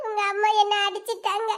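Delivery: soft tearful complaint; hurt, crying quietly. Acted. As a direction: sob softly while telling a friend. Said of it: "நீ எதுக்கிட்ட அழுதுட்டு வர".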